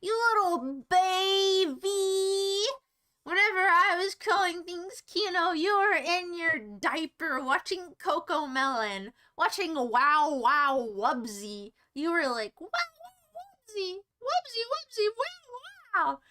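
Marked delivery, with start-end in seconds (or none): 0.0-2.6 high, long drawn-out mock taunt; higher-pitched slow teasing singsong. 12.6-16.2 put-on very high, stretched-out little kid voice; squeaky-high mock baby voice; mock toddler. Acts small child watching cartoon.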